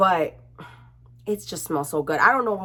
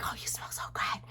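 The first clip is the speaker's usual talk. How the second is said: unvoiced whisper of awe; 16 dB quieter than usual. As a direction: whisper it to yourself as you walk away. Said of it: "she'd be like".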